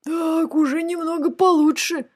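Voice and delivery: high, satisfied dragon voice; mock-contented storybook monster; pleased dragon head. A narrator's reading. Dragon head savours the sabre blows.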